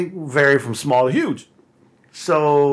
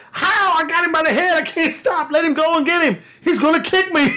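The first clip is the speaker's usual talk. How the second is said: mock panic. Being dragged by the calf.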